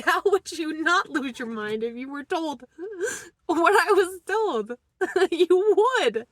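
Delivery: real laugh-cry, voice cracking. Real, not acted. A mother asking viewers how anyone stays sane, laugh-crying. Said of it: "laugh, cry".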